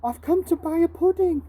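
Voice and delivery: insist, you've got a coin; a hungry boy protesting to the shopkeeper; pleading boy. Acted.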